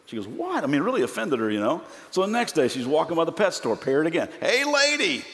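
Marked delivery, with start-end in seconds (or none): 0.3-0.6 high-pitched, indignant question; offended question. Acted. Offended woman answers the parrot. 4.4-5.2 high-pitched parrot calling out; squawking parrot. Acted. Parrot heckles her again.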